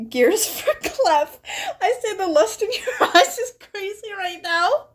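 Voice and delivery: high, giggling through the tease; laughing at her own joke. Real, not acted. Teasing line while laughing.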